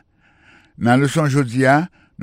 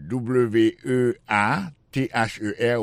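letters spelled slowly one by one; slower than usual. Shown as a demonstration. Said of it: "spelling the word out". (eple mo a lèt pa lèt)

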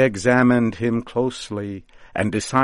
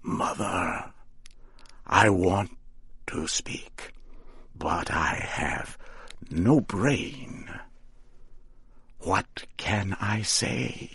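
soft, halting, barely voiced; new-made scarecrow whispering his first words; small whisper; 5 dB quieter, slower than usual. A narrator's reading. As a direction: struggle to get the words out. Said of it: "said in a small whisper".

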